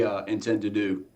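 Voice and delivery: ordinary delivery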